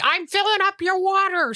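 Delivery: high, slow coaxing pet voice; talking to the cat. Retold or imitated. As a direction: coo it to the cat, slowly. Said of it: "I'm like".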